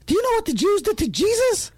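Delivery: squeaky-high mock kid voice; high-pitched boy. Gary challenging the narrator about Jesus, high-pitched mimicry. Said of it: "in his high-pitched voice".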